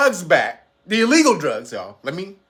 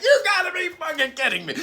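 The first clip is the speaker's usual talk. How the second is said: laughing quote; 9 semitones higher, faster than usual. Retold or imitated. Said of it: "He was like".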